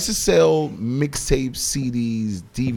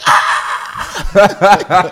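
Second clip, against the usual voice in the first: loud, high excited "ah" with laughter; pumped-up yell; 6 semitones higher, 6 dB louder than usual. Retold or imitated. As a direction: whoop like a thrilled fan. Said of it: "Yo, I was pumped".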